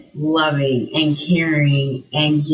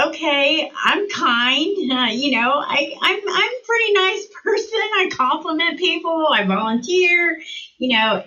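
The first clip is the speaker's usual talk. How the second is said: defensive self-talk; 10 semitones higher than usual. Retold or imitated.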